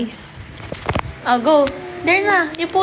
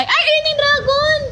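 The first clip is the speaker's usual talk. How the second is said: high-pitched squeal of discovery; excited exclamation; 11 semitones higher than usual. Real, not acted. Spots the awaited dragon gift. (matinis na sigaw sa tuwa)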